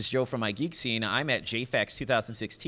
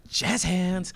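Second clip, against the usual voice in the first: playful; 8 semitones higher, 4 dB louder than usual. Acted. Playful aside.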